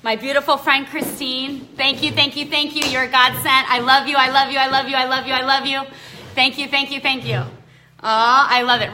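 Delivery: overflowing happy thank-yous; gushing thanks. Real, not acted. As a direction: pour out gratitude to a friend.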